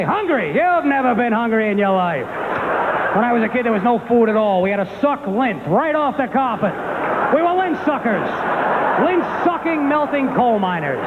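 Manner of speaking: high-pitched, hammed-up dad tirade; mock indignant dad, stretching the boasts; ranting father. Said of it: "My father'd be like".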